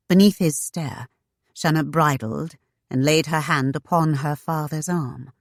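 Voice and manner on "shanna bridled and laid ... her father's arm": plain reading voice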